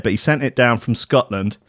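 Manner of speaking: ordinary talk, nothing marked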